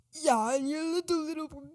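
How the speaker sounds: high, loud mock English accent; a jeering imitation of an English accent. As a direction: sneer it out in a put-on English accent. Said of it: "he talks so weird".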